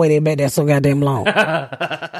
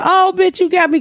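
surprised delight; 11 semitones higher than usual. Retold or imitated. Quisha finding her surprise cake, squealing high. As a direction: caught completely off guard. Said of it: "She said".

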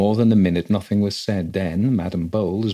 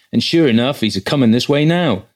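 a loud, hearty boatman, pitched higher; gruff boatman; 5 semitones higher, 8 dB louder than usual. A narrator's reading. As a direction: call out to the travellers like a burly quay boatman. Voices the rough boatman.

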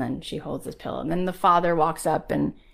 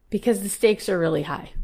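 ordinary delivery

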